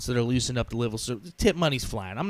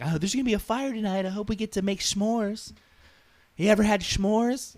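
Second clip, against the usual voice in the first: enthused passenger; 6 semitones higher than usual. Retold or imitated. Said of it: "genuinely just enthused".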